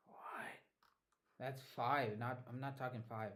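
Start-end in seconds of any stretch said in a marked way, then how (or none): 0.1-0.6 very soft, voiceless whisper to himself; whispered question. Real, not acted. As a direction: whisper it under your breath. Puzzled muttering to himself.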